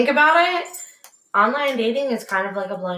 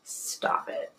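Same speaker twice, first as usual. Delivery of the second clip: whispered "stop it"; 13 dB quieter than usual. Real, not acted. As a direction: whisper it urgently. Whispered protest.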